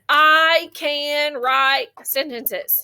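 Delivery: very slow, each word stretched. Shown as a demonstration. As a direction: say it one tapped word at a time. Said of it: "she tapped it on her hand".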